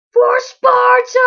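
high, slow, loud war cry; battle cry. Pretend. Gamer charging the enemy, slow high battle cry. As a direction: bellow it like a charging warrior.

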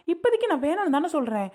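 high-pitched, indignant insistence; fed-up daughter's high protest; exasperated protest. Acted. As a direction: argue back at mother, pitch jumping up. Daughter protesting pressure to marry.

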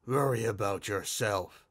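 masked man's muffled reply; acted muffled stranger. A narrator's reading. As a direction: talk as if through a mask, a little low. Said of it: "he responded with a muffled voice".

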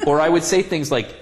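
ordinary talk, nothing marked

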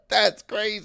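high laughing disbelief. Real, not acted.

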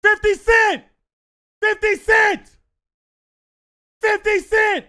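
high, full-throated shouted name; kid yelling. Retold or imitated. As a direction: yell it across the arena like an excited kid. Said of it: "I hear a kid yelling".

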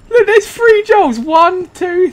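overexcited discovery; excited amazement. Real, not acted. Amazed at three Joels.

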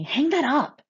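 loud, high-pitched command; sharp shout, pitched well up; yelled order. A narrator's reading. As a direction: yell it as an urgent order. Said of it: "Frosty yells at me".

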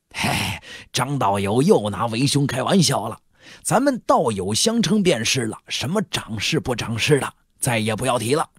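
genial middle-aged merchant voice, put on; hearty host. Shop manager insisting the guest drop formal titles, hearty and familiar. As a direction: brush off the teasing chummily.

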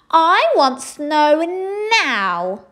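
loud, high, slow demand; screaming. A narrator's reading. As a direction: shriek the demand like a tantrum. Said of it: "she screamed".

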